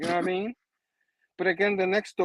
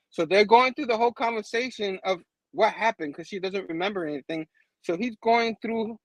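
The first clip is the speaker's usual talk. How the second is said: normal talking voice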